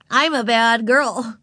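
put-on high, loud, slow child's announcement; winning little girl. A narrator's reading. Ramona interrupting to charm the librarian, high and proud. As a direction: declare it with a smile, pleased. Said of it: "smiling winningly at the librarian".

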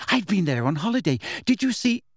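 rising excitement in the voice; excited. A narrator's reading. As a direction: gush until suddenly cut off. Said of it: "said Hermione excitedly".

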